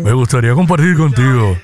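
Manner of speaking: low, put-on seductive voice; hammed-up come-on, pitched deep; mock sensual voice. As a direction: play the sultry flirt, voice dropped low.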